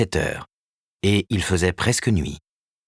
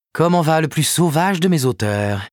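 a touch higher, playful agent greeting the author; lightly voiced Karen, teasing; 10 semitones higher, 4 dB louder than usual. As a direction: tease him as you say hello.

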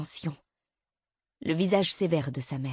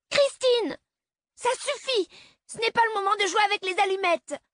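put-on high, loud scolding child voice; a six-year-old scolding her mother like a parent; stern six-year-old; 4 dB louder than usual. A narrator's reading. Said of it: "dit-elle du haut de ses six ans". (fillette de six ans qui gronde, voix aiguë)

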